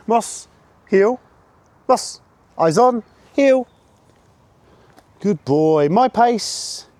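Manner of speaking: bright high-pitched call to the dog; high dog command. Real, not acted. Handler calling his puppy to heel, high and loud.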